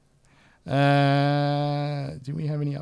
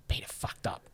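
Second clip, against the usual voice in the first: whisper; 7 dB quieter than usual. Real, not acted. Whispered aside after laughing.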